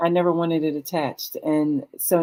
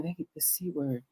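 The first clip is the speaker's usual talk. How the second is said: whispered; 12 dB quieter than usual. Retold or imitated. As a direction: hush the C word almost to nothing. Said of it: "like, whisper it".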